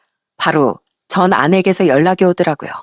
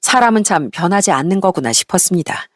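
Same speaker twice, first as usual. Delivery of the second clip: ordinary delivery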